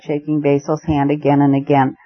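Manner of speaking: ordinary delivery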